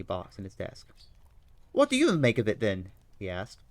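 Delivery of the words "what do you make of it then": eager detective reading the news aloud; Holmes voice. Acted. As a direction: play Holmes, keen and bright over the paper.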